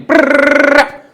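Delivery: loud, high machine-gun trill; mock shouted gibberish. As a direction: imitate someone blasting noise at you. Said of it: "just started shouting at you".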